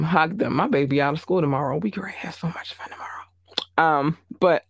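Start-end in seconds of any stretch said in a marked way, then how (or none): 1.8-3.2 fast, much softer whisper; giddy whisper. Real, not acted. Mother whispering about fun with her baby tomorrow. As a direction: whisper it like a happy secret. Excited about daughter's day off.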